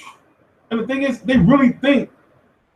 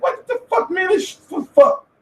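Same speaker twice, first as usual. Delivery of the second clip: exasperated high yell; 10 semitones higher than usual. Retold or imitated. Yelling at fans who think McGregor has power, high and exasperated. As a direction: blow up at them, voice cracking high. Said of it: "I'm like".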